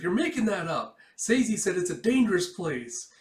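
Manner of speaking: put-on girl accusing the commander. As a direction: argue back sharply. Girl arguing back.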